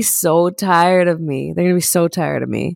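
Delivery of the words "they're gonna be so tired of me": usual speaking voice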